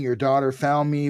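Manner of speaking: usual speaking voice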